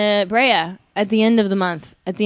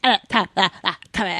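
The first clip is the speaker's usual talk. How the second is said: put-on sister voice, protesting; sister impression; faster than usual. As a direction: mimic your sister's denial. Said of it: "she doesn't think she talks like that".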